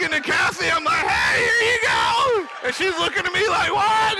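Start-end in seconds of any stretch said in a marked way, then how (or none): 1.1-2.5 high, loud, drawn-out mock drunk; slow, whooping tipsy call; drunk on joy. Student hands check to administrator, drunk on joy. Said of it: "I walk back in all drunk". 3.7-4.2 high, loud mock astonishment; stunned disbelief. Administrator staring at the returning student, stunned disbelief.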